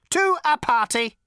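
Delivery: high, loud solemn machine; deadly serious robot. A narrator's reading. Robot finishes telling Zaphod its plans, utterly serious. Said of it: "said the robot in all seriousness".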